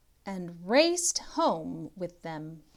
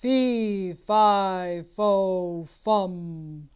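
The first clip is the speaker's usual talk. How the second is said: loud booming giant, each word hit separately; 8 dB louder, much slower than usual. Acted. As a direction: boom it out like a giant. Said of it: "a voice boomed".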